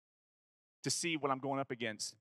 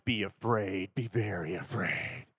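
low, slow, ominous put-on voice; hammed-up spooky threat; mock horror-movie menace; 7 semitones lower, slower than usual. Mock ominous warning to rivals.

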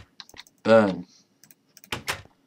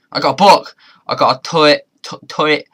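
loud, higher, thrilled announcement; excited; 5 semitones higher, 7 dB louder than usual. Real, not acted. Gamer crowing to viewers about the book he found. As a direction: crow over the new find.

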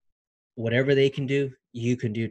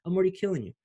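higher, punchier second take; excited repeat; 6 semitones higher, much faster than usual. Real, not acted. Pitched up as he hypes his viewers. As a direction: hit it harder, pitched up.

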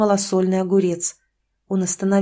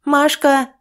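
high, loud call; mother calling daughter from the threshold, loud; shout from the doorway; 6 semitones higher, 4 dB louder than usual. Acted. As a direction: yell for her daughter from the door. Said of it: "крикнула с порога".

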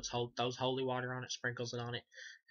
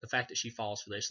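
ordinary talk, nothing marked

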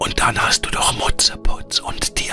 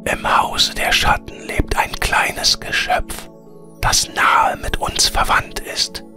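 air spirit whispering. A narrator's reading. Air spirit bids Veronika farewell, in a whisper.

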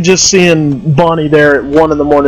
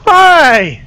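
bright sarcastic greeting, pitched up; mock cheery hi; 9 semitones higher than usual. Streamer mockingly greets the animatronic, falsely cheery. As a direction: greet the monster like an old friend.

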